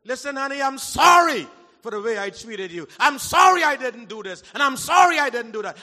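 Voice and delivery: a hint of a husband shouting his sorry to his wife; loudly voiced husband apologizing to his wife; pretend husband's apology. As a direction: belt out the apology to honey as the husband.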